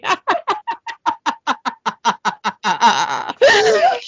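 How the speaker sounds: long, high, real laughing fit; drawn-out helpless cackling. Real, not acted. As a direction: lose it completely in laughter. Laughing fit at own slip.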